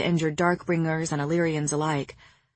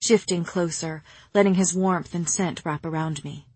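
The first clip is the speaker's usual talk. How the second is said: usual speaking voice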